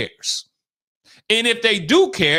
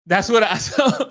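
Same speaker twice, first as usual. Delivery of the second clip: ordinary talk, nothing marked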